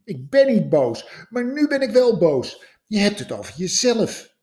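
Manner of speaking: from firm to high, loud anger; queen snapping at the scientist; growing anger. Acted. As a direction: let the anger build up. Queen grows angry.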